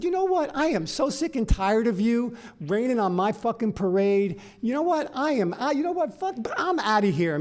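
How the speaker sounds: high-pitched, exasperated rant; fed-up outburst. Retold or imitated. Guest sick of the toad raining on his parade, fed-up outburst. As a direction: blow up and storm off.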